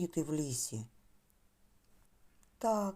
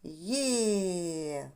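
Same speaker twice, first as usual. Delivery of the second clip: long-held joyful «є», gliding down; rejoicing bunny. Acted. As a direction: cheer like the happy hare. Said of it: "як зайчик радів". (протяжний радісний вигук зайчика)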